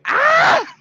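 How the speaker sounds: high, loud sudden "Ah!"; a shriek of pure fright; the panic inside, let out as a yell; inner scream. Retold or imitated. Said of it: "my heart's going".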